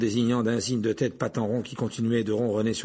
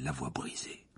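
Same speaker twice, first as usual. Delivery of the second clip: ordinary delivery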